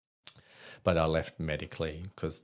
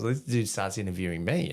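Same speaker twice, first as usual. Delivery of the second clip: normal talking voice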